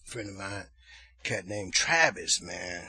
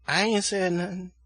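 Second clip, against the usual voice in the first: loud, high-pitched imitation of the nephew protesting; singsong denial; 8 semitones higher, 7 dB louder than usual. Cussing nephew answering his aunt, high mock singsong. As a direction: play the boy's sung-out denial. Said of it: "they all talk like they singing".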